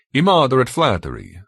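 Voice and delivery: high, formal, emphatic pronouncement; Benito. A narrator's reading. Benito telling how George got here, formal verdict. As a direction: deliver the verdict with stately certainty.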